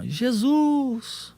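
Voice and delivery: mock shout held high; imitated distant cry. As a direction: stretch it out like a far-away cry. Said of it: "Rapaz, um grito".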